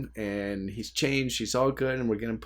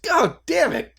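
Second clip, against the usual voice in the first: a sudden curse, much higher; mock frustrated curse; 5 dB louder than usual. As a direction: blurt it out like a tired dad caught off guard. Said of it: "my first reaction is like".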